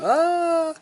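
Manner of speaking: sudden high-pitched yelp of fright; startled high cry. Acted. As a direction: jump at it with a shriek. Monster jumps out at Ollie.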